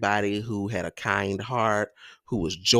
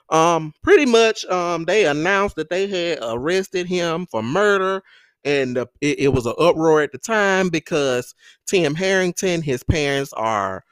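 excited; 6 semitones higher, 5 dB louder than usual. Real, not acted. Excited emphasis in story.

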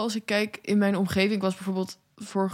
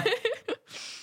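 nervous laugh; 6 dB quieter than usual. Real, not acted. Nervous laugh at tense question.

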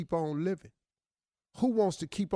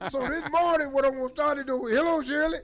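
crazy man voice; 7 semitones higher than usual. Pretend. Crazy man greeting cohosts.

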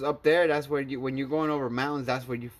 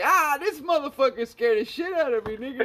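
high-pitched laughing panic; laughing scared; 10 semitones higher than usual. Retold or imitated. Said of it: "I was like".

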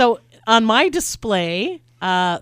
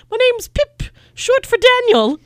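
very high, loud mock British accent; ridiculous British accent; 8 dB louder, slower than usual. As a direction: imitate a silly British ad read, pitched way up. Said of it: "the ad in this British accent".

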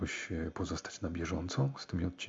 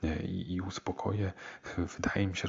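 ordinary delivery

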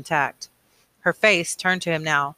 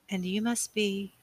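soft, slow, slightly raised pitch; trailing off; 4 semitones higher, 8 dB quieter, slower than usual. A narrator's reading. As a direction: start a hesitant guess and let it hang. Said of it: "Her voice trailed off".

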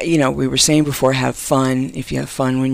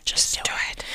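whisper; 8 dB quieter than usual. Acted. Whispered Nike slogan.

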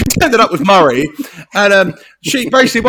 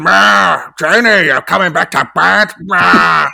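creepy man calling; 5 dB louder, slower than usual. Retold or imitated. Imitating creepy older man calling.

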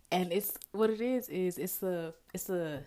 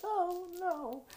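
high-pitched mock whimper; hammed-up tearful moan; dramatic fake crying; 10 semitones higher than usual. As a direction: wail it like a pretend crybaby. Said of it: "I was just dramatically fake crying".

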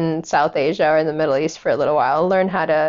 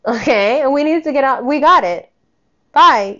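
louder, high dismissal ending in a blunt bye; raised, scornful sendoff; dismissive anger; 6 semitones higher, 5 dB louder than usual. Real, not acted. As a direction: wave her off angrily. Dismissing the mother.

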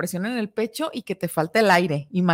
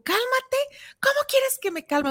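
much higher, indignant echo of „Cálmate“; exasperated retort. Retold or imitated. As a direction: throw the word back in disbelief. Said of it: "y tú".

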